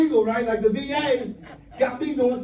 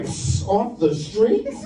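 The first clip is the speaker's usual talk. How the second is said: snobby; much slower than usual. Retold or imitated. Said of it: "I got all snobby".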